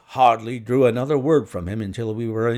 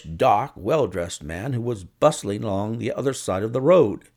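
plain reading voice

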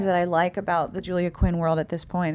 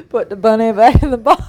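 laughing, louder bad Southern imitation; bad Southern accent; 2 semitones higher, 8 dB louder than usual. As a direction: quote the line through laughter. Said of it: "It was the worst Southern accent".

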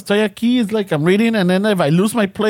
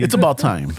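low radio announcer read; deep sponsor read like a DJ; 8 semitones lower than usual. Retold or imitated. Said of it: "my announcer voice".

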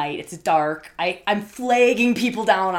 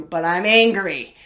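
loud angry outburst; 8 dB louder than usual. Retold or imitated. Peak of retold anger.

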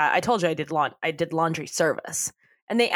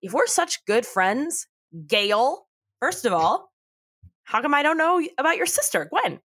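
mock indignant Mitch; 7 semitones higher than usual. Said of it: "And Mitch says".

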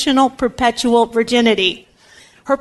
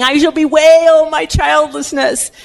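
slow, higher-pitched theatrical wail, mild; acted lament; 5 semitones higher, slower than usual. Anna mourns alone over having no child, lightly acted lament. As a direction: draw it out as a mock lamentation. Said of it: "mourning and lamenting on her own".

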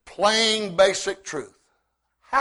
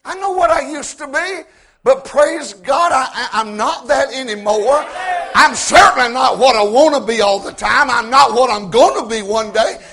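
rising testimony that builds to a loud shout on the last line; preaching at full force; 3 semitones higher, 7 dB louder than usual. Acted. Preacher testifying to the congregation, building to full shout.

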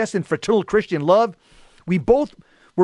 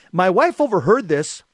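normal talking voice

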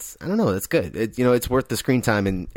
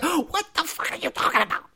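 very high, strained squeal, held back in volume; mock freak-out; 13 semitones higher, 5 dB quieter than usual. Retelling how she flips out and leaves, mock freak-out. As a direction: imitate her flipping out in falsetto. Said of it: "she flips out and leaves".

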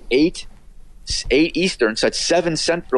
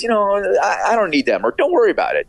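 imitated brush-off; 9 semitones higher than usual. Retelling colleague waving off the offer.

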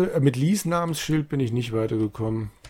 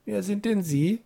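unsure question; 9 semitones higher than usual. A narrator's reading. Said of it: "fragt er unschlüssig".

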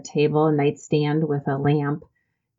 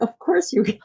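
reassuring reply; 8 semitones higher than usual. Retold or imitated. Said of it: "And I'm like".

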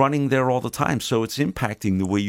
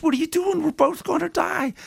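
very high, squealing frog voice in panic; panicked frog. Acted. Stung frog cries out to the scorpion, panicked high squeal. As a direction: shriek it like a frog just stung. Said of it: "frog goes".

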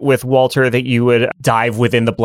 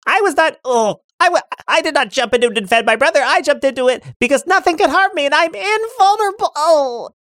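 high, proud denial; proud boy voice. Acted. Lark insists to his brother, high and proud. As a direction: deny it, high and haughty.

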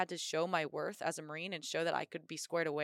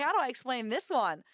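higher, louder playful panic; mock worried question; 6 semitones higher, 5 dB louder than usual. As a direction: fret about telling the boss.

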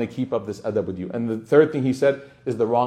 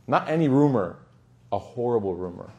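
ordinary talk

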